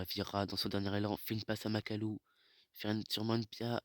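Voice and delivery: normal talking voice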